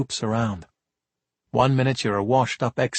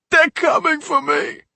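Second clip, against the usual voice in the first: put-on much higher, slow childlike wail; crying plea; 4 dB louder than usual. Sobbing kid begs the cops, wailing. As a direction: cry like a toddler. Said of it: "The sobs racked his big body".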